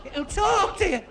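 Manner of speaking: very high-pitched, strained calling; shouting for deaf gran. Acted. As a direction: push the voice right up to get through to her. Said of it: "my gran was a bit Mutt and Jeff".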